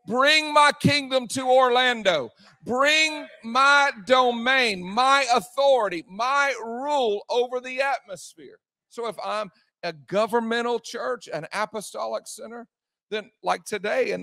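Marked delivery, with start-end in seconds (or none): 0.1-8.6 preaching God's command. Acted. Pastor voicing God's call to the congregation, shouting. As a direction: proclaim it like a decree from the throne. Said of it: "He says".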